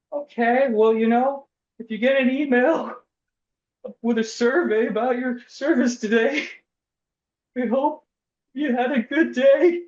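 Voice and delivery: customer service voice. Retold or imitated. Shaken teller wishing customer good day, chirpy. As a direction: chirp the scripted sign-off like a teller.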